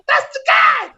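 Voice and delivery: very high imitation of a crowd; mock squeal of recognition; excited call-out. Whole train recognizing the comic from the show, excited high cry.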